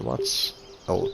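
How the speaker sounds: usual speaking voice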